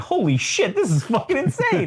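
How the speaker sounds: mock amazement that climbs high and cracks into a laugh; stunned reporter. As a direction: play the reporter freaking out, half-laughing. Voicing a stunned comic reporter.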